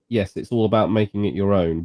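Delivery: ordinary talk, nothing marked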